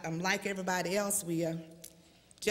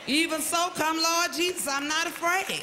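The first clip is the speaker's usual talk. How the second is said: fervent cry; 9 semitones higher, 5 dB louder than usual. Acted. Preacher voicing believer's cry.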